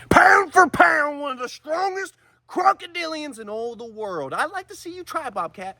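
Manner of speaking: booming announcer voice, stretched out; mock announcer hype. Host hypes the caiman like a fighter, booming announcer voice.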